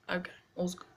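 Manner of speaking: unvoiced reassurance to herself; whispered aside. Real, not acted. Calming herself after mom's anger, whispered. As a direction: whisper it to settle yourself.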